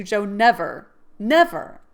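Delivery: stamp each never; Laurie's fierce oath. Acted. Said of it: "with a stamp of emphasis".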